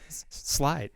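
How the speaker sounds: hushed Fight Club delivery; quiet movie line. Pretend. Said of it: "your Fight Club reference".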